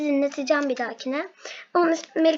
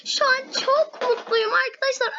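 happy tears; 7 semitones higher than usual. Real, not acted. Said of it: "Ağlayacağım gerçekten".

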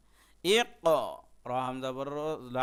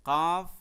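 কাফ called out higher and louder; কাফ, raised and louder; emphatic; 4 semitones higher, 8 dB louder, much slower than usual. Shown as a demonstration. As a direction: hit কাফ hard, like a teacher. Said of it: "আমি আবারও বলতেছি".

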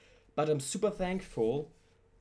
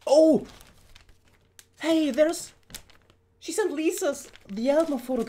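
excited squeal; 12 semitones higher, 7 dB louder than usual. Real, not acted. Finds [name] photo card.

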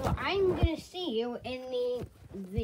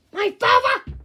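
shocked; 7 semitones higher, 9 dB louder than usual. Acted. Shocked at father's death.

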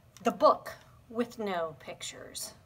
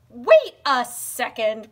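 loud and slow; 9 dB louder than usual. Acted. Reader protesting.

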